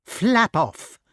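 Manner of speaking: high, loud dismissal; witch crying out. A narrator's reading. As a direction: send it away sharply as a witch. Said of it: "cried Madam Mim".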